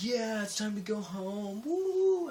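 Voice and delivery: high, drawn-out whooping cheer; retold cheer. Crew cheering that it's time to go home, excited. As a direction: celebrate quitting time with a rising woo.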